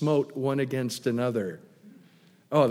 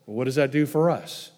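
normal talking voice

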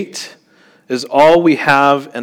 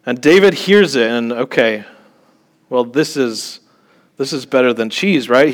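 usual speaking voice

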